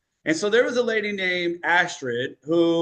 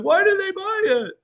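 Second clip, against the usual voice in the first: mock confused whine; 4 dB louder than usual. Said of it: "You're like".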